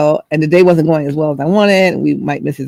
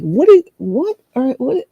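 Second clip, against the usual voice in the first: confused what; 9 semitones higher, slower than usual. Retold or imitated. Confused reaction.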